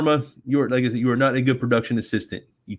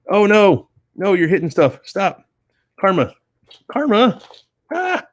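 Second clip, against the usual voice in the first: higher and louder startled cries rising to a squeal; yelping as the cat hits things; real alarm; 8 semitones higher, 3 dB louder than usual. Real, not acted. Cat hitting the laptop.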